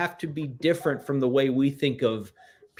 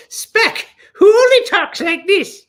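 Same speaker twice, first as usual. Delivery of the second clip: very high, loud cartoonish leprechaun voice; Spec; 7 dB louder than usual. Demonstrating how Spec talks, very high put-on leprechaun voice. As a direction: squawk it out like a hyper little leprechaun. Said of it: "this is how Spec talks".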